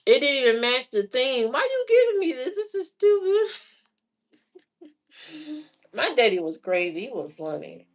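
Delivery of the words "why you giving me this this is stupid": very high, fast whiny complaint; indignant whine. Retold or imitated. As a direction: sulk at the silly gift. Her complaint to dad.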